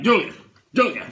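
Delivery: loud call across the cabin; mock urgent call to his wife. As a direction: call her name urgently.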